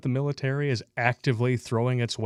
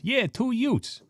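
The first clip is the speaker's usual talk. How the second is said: much higher goofy voice; high silly voice; 12 semitones higher, slower than usual. Acted. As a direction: answer in a squeaky higher voice. Second voice in skit.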